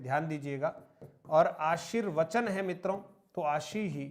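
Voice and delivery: normal talking voice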